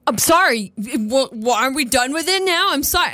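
imitating an impatient, insincere sorry; mock a huffy apology that rushes to be finished; rude apology. Imitating partner ending a fight, rude impatient apology. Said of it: "It's so rude when you do".

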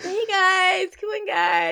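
mock sweet pleading voice; imitating herself. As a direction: parody her own sweet tone. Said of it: "That's not how I sound".

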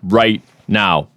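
ordinary talk

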